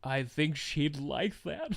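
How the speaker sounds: low, soft and slow actor impression; quiet, deep, deliberate movie-dad voice; Liam Neeson. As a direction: imitate him with a hushed, measured delivery. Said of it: "I like the Liam Neeson voice".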